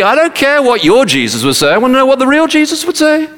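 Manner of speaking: high-pitched, mock-exasperated protest; exasperated retort. Preacher voicing what we're all thinking, exasperated high protest. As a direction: push back, pitch climbing high. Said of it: "And we're all thinking".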